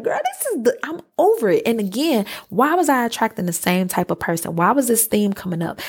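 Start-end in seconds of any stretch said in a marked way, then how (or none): 0.0-1.6 much higher exasperated mock squeal; over it. Fed up with the crying friend, high exasperated. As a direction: throw up your hands, high and done. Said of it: "It was just like".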